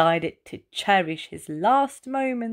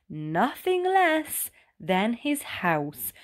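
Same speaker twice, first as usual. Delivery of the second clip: ordinary delivery, nothing marked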